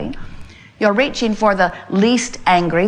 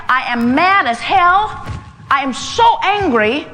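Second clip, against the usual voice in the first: slow, raised, higher angry declaration; higher, slower voice owning the anger; mad as hell; 5 semitones higher, slower than usual. Shown as a demonstration. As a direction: let the anger out, slowly and boldly. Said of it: "And stop and say".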